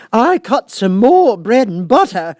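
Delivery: high, loud, slow quavering plea; trembling Hatter. A narrator's reading. Hatter pleads with the King, trembling high and slow. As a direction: beg for mercy, voice shaking.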